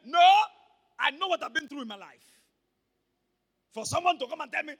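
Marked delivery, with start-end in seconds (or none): none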